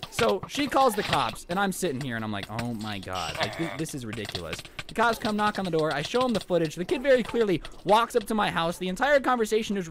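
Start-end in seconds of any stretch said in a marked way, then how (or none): 2.6-4.6 low, quiet, fed-up disbelief; weary exasperation. Retold or imitated. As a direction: say it under your breath, worn down. His exasperated reaction while waiting.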